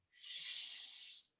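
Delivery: long unvoiced snake hiss; drawn-out s like a snake. Shown as a demonstration. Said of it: "like a snake".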